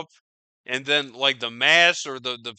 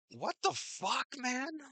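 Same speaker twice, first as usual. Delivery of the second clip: high, soft, breathy-free mock disbelief; stunned disbelief; 9 semitones higher, 9 dB quieter than usual. Imitating a stunned bystander, high and soft. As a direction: let it rise high and quiet. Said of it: "and it's just like".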